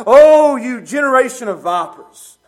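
loud, high scornful "Oh" into a rebuke; scornful outcry. Acted. Jesus denouncing the Pharisees, loud scornful cry. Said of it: "and he says".

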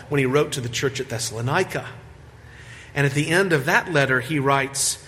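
ordinary delivery

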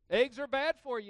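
loud, punchy mock health slogan. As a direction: bark it like a headline. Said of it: "You ever heard that?".

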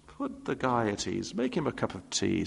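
ordinary talk, nothing marked

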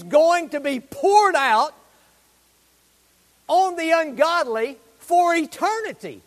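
preaching at full force. Acted. Preacher warning the congregation of judgment, loud and forceful.

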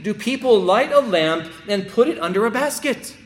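loud, high, climbing pulpit cry; preacher rising to a climax before the congregation; full-force preaching. Acted. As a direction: build it to a shout of conviction. Preaching city on a hill.

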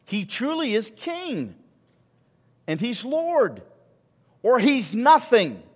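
slow, weighty declaration; slow emphatic preaching. Acted. Pastor answering those who reject Christ, slow and weighty. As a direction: lay it down word by word.

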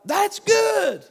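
high, loud preacher's exclamation; emphatic shout. Real, not acted. Preacher telling church he can be replaced, shouted emphatically. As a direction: shout it out, pitch leaping up.

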